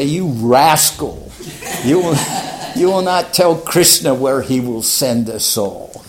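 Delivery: slow, loud scold of a cheeky student; mock scolding guru. Prabhupada scolding playfully.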